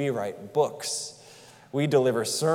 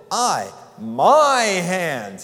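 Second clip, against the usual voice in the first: "my hands" said very slowly and louder, each word leaned on; emphatic my hands; 4 semitones higher, 7 dB louder, much slower than usual. Acted. Preacher voicing God stressing "my hands", slow and emphatic. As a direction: lean hard on "my hands". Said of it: "you can almost hear him say".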